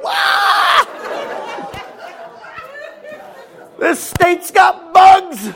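hammed-up panic at a flying bug; mock scream.

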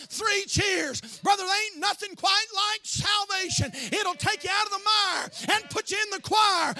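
preacher rejoicing over salvation; full-force preaching. Real, not acted. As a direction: whoop it up with joy.

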